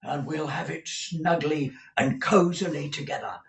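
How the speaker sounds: cosy manager. A narrator's reading.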